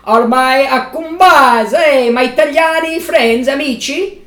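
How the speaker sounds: hammy Italian host voice; Italian accent. Pretend. Hosts intro as Italian.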